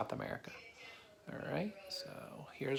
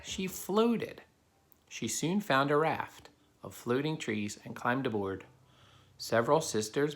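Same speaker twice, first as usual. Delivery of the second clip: put-on steady storybook narration for kids; reading a story aloud; 5 dB louder than usual. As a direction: read it to the class like a picture book. Reads a picture book to children.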